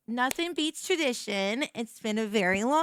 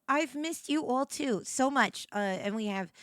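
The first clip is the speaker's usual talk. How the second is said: normal talking voice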